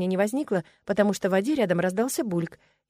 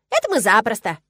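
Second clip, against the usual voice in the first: loud, eager squirrel; overjoyed squirrel; 3 semitones higher, 7 dB louder than usual. A narrator's reading. Squirrel promises it is easy, overjoyed. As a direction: exclaim it gladly. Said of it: "обрадованно подпрыгнул белк".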